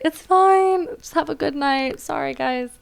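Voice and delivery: high, sweet consoling voice; smooth it over for an upset guest; retold reassurance. Consoling embarrassed crying mother.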